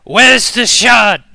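slow, high, very loud banshee cry; loud wail. Acted. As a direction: wail it out, stretching every word. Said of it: "she lets out this mournful wail".

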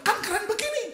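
very high-pitched mock indignation; acted-out, high-voiced self-justification; insistent protest. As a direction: squeak it out as a protesting son. Son arguing back in retold quarrel.

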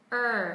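Er with lips pushed forward and tongue curled; American Er with rounded lips; r-colored er. Shown as a demonstration. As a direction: curl the tongue back for Er. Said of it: "âm er".

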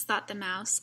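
usual speaking voice

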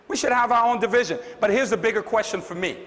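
usual speaking voice

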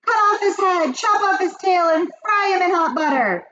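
very high, loud clamour; shrieking witch mob; bloodthirsty witches' cry. A narrator's reading. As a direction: cry it out with vicious glee. Witches chant punishments for boy.

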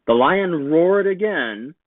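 usual speaking voice

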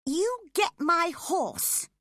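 high, loud, slow command; bossy girl commanding a servant; ordering. Acted. Spoilt girl ordering her cousin about, high and slow. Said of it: "she ordered him".

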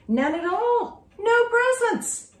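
high, slow, wailing, half laughing; hammed-up childish wail; wailing girl. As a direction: whine it out dramatically. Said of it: "She called her friend ... and said".

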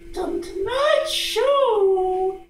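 ordinary delivery

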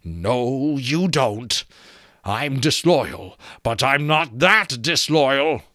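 emphatic skull protest; defiant skull. A narrator's reading. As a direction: stretch it out with growing force. Skull turning on them, voiced.

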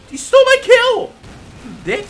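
high, loud indignant yell; outraged shout. Real, not acted. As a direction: yell at the thief. Kill stolen outrage.

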